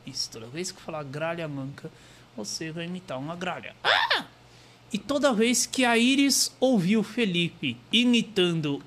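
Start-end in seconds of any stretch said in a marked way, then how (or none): 3.8-4.2 high sharp crow caw; crow squawk. Pretend. Felipe triggered into crow, loud high squawk.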